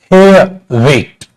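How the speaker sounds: हेयर, वेट drawn out slowly; careful pronunciation demo; slow sound demo. Shown as a demonstration. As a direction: stretch each vowel in हेयर, वेट. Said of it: "जैसे देखो ना".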